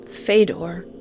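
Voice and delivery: ordinary delivery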